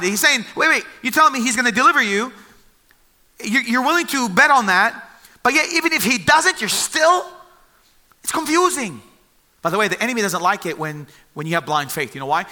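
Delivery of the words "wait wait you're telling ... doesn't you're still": higher, louder, fast flustered questioning; sputter, blindsided; confused disbelief. Retold or imitated. Blindsided king questioning the three men, flustered disbelief. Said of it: "he's confused. He's blindsided".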